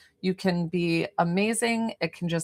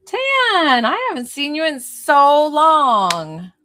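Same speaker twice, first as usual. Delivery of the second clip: high, loud, drawn-out squeal of welcome; delighted greeting; 7 semitones higher, 8 dB louder, slower than usual. Real, not acted. Healer greeting someone not seen in so long, excited. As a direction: stretch the words out in excited delight.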